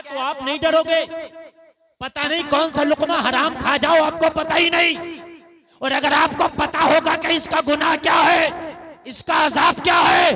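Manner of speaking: loud, ringing sermon delivery; fiery preacher's call; preaching at full force. Acted. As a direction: thunder it to the gathering.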